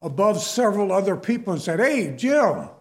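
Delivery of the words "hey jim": calling out across a room to get attention; loud mock call from across the crowd; retold yell. Coworker calling out to Jim in front of everyone, loud. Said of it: "this guy yelled out at me".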